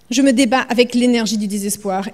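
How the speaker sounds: plain reading voice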